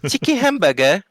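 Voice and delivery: high-pitched British questioning lilt; mock English accent. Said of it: "they call it a".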